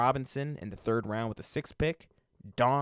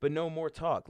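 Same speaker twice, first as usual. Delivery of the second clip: ordinary talk, nothing marked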